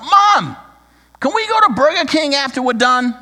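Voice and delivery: put-on high, loud excited child's voice; excited child blurting. Four-year-old son begs his mom in the store, high and loud. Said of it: "the four-year-old blurts out".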